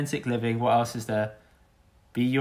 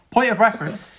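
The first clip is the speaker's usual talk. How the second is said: mock-formal; 6 semitones higher than usual.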